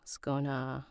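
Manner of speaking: usual speaking voice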